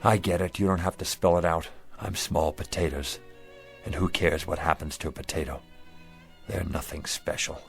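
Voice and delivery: low, subdued self-pity; resigned hurt. Acted. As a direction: sink into quiet defeat. He accepts he is rejected.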